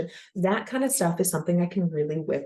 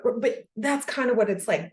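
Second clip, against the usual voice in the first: ordinary talk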